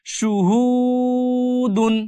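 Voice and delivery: সুহুদুন drawn out slowly, only the middle হু stretched, first সু kept short; slow recited demo. Shown as a demonstration. Teacher showing students the correct সুহুদুন, slowly recited. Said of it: "মাঝখানে টান হবে".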